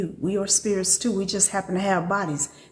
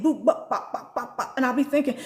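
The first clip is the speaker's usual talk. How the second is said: ordinary talk, nothing marked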